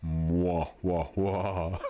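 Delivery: voiced sad trombone, slow wah-wah. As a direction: mimic a falling trombone fail. Plays the sound again.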